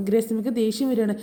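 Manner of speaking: normal talking voice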